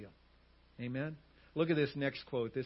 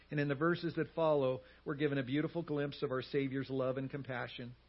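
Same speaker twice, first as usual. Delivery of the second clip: normal talking voice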